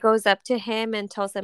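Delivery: ordinary talk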